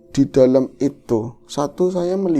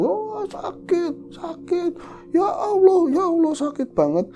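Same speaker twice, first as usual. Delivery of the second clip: pained wail. Retold or imitated. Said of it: "teriak-teriak kesakitan".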